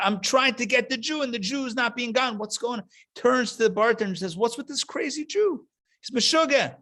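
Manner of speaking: high, frantic exasperated rant; freaking out. Retold or imitated. The anti-Semite complains to the bartender, high and frantic. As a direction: sputter like a man losing it.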